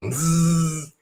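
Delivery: buzzing bee noise. A narrator's reading. Bees buzzing in the story.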